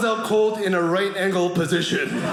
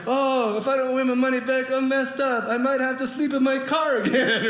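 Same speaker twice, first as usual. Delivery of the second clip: higher-pitched fake woe; fishing for pity; 5 semitones higher than usual. Comic at slot machine baiting rich friend, fishing for pity. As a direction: lament for someone to overhear, hammed-up. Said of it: "I'm trying to bait this guy".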